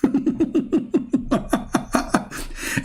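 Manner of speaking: high, wheezy real laughter; long helpless giggle; laughing hard. Real, not acted. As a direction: crack up at your own story. Cracks up at his story.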